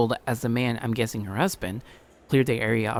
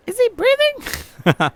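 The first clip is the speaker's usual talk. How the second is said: much higher, slow and drawn out; high woman voice; 6 dB louder, much slower than usual. Shown as a demonstration. Correcting his voice to the woman asking if the boy breathes. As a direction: redo the line as a worried woman, slowly. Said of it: "that's a woman".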